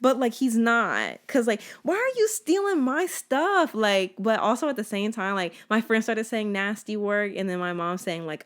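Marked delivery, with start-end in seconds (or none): 1.8-3.7 high-pitched playful whine; mock indignation. To dad who took the catchphrase, mock indignant. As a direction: protest in a much higher voice.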